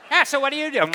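loud, high-pitched mock hairdresser; gum-chewing hairdresser. Hairdresser quizzing her client mid-haircut, loud gum-chewing caricature. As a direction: exaggerate a brash, chewing-mouthed chatterer. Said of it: "chewing her gum and cutting my hair".

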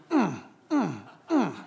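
a soft mm-mm of disapproval; disapproving hum. Retold or imitated. The Holy Spirit convicts the preacher with a soft disapproving hum. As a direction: hum like a gentle no. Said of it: "The Holy Spirit is going".

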